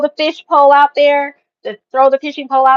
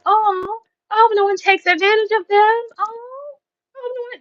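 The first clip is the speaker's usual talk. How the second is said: mock pity; 11 semitones higher than usual. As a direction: coo over them like poor dears. Mock pity for sweet locals.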